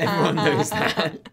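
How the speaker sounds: slow, laughing through the words; chuckling mock certainty. Real, not acted. Joking answer, laughing.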